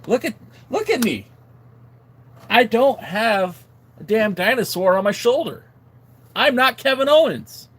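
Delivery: hammed-up indignant denial; mock outrage. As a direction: insist loudly, as if deeply insulted. Mock outrage at being called Kevin Owens.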